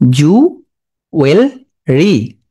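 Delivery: জুয়েলারি broken as "ju ... wel ... ree"; very slow জুয়েলারি, long pauses between syllables; spelling pronunciation. Shown as a demonstration. Teacher dictating how to remember it, slow chunked জুয়েলারি. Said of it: "বলবা?".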